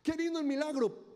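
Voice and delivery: mock delight. Voicing delighted believers.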